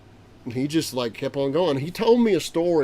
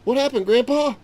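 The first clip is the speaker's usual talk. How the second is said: eager question; 11 semitones higher, 6 dB louder than usual. Retold or imitated. Eager grandson interrupts.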